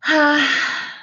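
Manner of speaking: a long sympathetic sigh; Eliza's sigh. Acted. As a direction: breathe out in pity for the creature. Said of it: "Eliza sighed".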